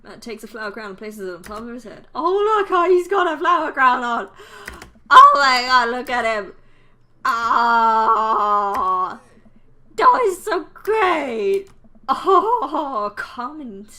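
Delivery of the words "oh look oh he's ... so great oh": delighted gushing. Acted. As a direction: gush at top volume over something adorable. Gushing at flower crown on him.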